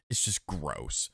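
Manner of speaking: ordinary talk, nothing marked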